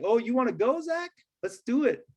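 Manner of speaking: squaring up like he wants to go; defensive challenge. Retold or imitated. Student mocking teacher, defiant and challenging taunt. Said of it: "Darren's gonna be like".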